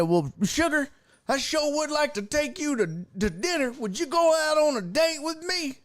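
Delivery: high-pitched, hammed-up sweet-talk; country suitor. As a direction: court her like a smitten country boy. Imitates the man asking her out.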